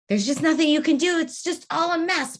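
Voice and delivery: acted colleague losing it over a mess; exasperated outburst. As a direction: vent like you're at the end of your rope. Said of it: "Person A is beyond themselves right now".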